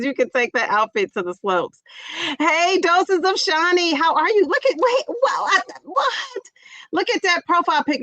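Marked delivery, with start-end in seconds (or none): 2.4-8.0 very high, startled stammer; surprised. Real, not acted. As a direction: do a double take at the picture. Surprised by profile pic.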